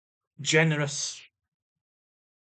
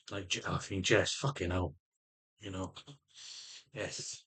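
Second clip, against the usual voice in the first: low, much softer muttered curses; quiet muttering; 9 semitones lower, 10 dB quieter than usual. Real, not acted. Man venting about his meds, muttering low and soft. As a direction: grumble it under your breath.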